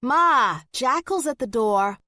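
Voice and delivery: put-on high, loud teenage yell. A narrator's reading. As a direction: yell through the house that someone's at the door. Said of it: "turned her head and yelled".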